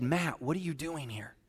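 hushed personal question; quietly, as if God whispers your name; soft voice of God. Retold or imitated. God whispering to Matt, hushed.

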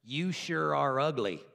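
lightly voiced rude parrot, drawn out; parrot's insult. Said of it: "the parrot looks at her and says".

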